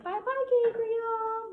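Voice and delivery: high, slow, sing-song goodbye; creepy baby voice. Retold or imitated. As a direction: coo it like talking to a baby. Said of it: "that creepy child baby voice".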